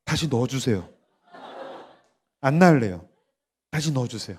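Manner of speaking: low, softened comic plea; mother's deadpan refusal. Acted. Mother tells doctor to put baby back, subdued. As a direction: deliver the refusal small and low. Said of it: "그러면 엄마가".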